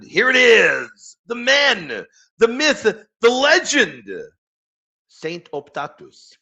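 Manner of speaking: loud, high and slow, building up the big reveal; hyped announcement. Real, not acted. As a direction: announce it like a wrestler's entrance. Hyping up the saint's quote.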